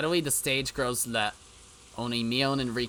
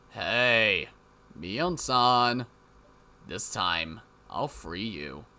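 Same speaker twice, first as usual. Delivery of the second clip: sinister villain; 5 semitones lower, much slower than usual. Acted. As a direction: menace her gently. Sinister figure addresses Mion.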